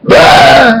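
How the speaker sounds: high, startled holler; retold dad's scream. Dad screams as son opens the door, startled yell. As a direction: scream as if walked in on. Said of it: "I open the door and Dad goes".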